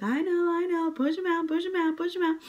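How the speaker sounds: high, urgent coaxing push him out; coaxing doctor. Retold or imitated. Doctor to the mother in labor, high urging. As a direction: urge her on like the doctor. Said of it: "the doctor's like".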